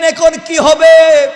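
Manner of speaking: high, loud, drawn-out mock cry; preacher acting out a wail; retold outcry. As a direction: cry out as the alarmed onlookers did. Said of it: "চিৎকার শুরু করে দিলেন".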